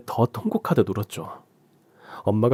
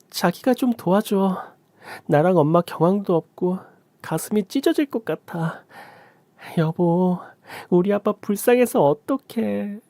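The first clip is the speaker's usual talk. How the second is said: much higher, slow mock grief; fake sobbing; 9 semitones higher, slower than usual. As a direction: play the grieving wife to deceive him. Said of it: "전 연기를 이어갔습니다".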